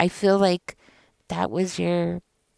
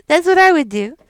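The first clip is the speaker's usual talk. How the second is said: laughing; 10 semitones higher, 8 dB louder than usual. Real, not acted. Laughing after her own comeback.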